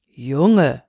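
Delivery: low, drawn-out address; deep-pitched moon calling a boy to order; admonishing old moon. A narrator's reading. Moon halts the restless boy, admonishing.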